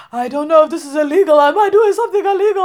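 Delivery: high, loud panicked whine, laughing. Retold or imitated. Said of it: "he was like".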